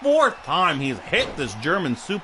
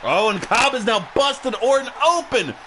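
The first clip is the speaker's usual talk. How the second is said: rising, louder play-by-play call; wrestling commentator; 12 semitones higher, 4 dB louder, slower than usual. Acted. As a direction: call the near-fall live. Live play-by-play excitement.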